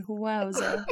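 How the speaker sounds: laughing quote. Retold or imitated. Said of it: "I looked to you and said".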